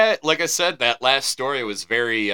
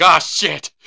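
startled curse, a bit louder; hammed-up fright; mock scared yelp; 5 semitones higher, 6 dB louder than usual. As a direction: pretend to get spooked. Acting out scared reaction.

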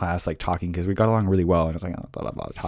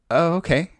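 higher, awkward polite brush-off; unconvinced seniors; 7 semitones higher than usual. Retold or imitated. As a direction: mimic older kids humoring an obvious lie. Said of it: "And they were like".

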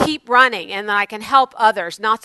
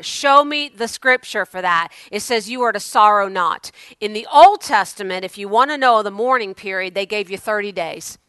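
ordinary talk

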